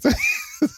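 high-pitched burst of laughing; helpless laughter. Real, not acted. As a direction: crack up completely. Cracking up at the wire work.

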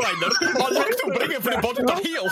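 put-on high-pitched, laughing Australian Borat accent. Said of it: "That's Australian Borat".

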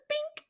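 tiny very high-pitched "bink"; cartoon flick sound effect; imitated flick noise. Imitating a flick across the room.

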